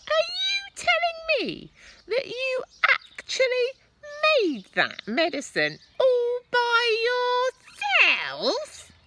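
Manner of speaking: put-on very high, loud incredulous old woman; doubting grandma yelling down; Grandma shouting. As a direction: demand it suspiciously from above. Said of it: "she shouted".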